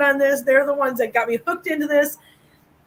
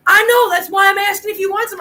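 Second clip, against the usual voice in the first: retold call across the street; 6 semitones higher, 3 dB louder than usual. Homeless man calling across street.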